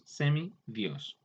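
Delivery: "semidios" slowly as semi, then dios; split into parts. Shown as a demonstration. Teacher showing a compound's parts, "semidios" slow and separated. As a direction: pull "semidios" apart into its two words. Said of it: "al compuesto".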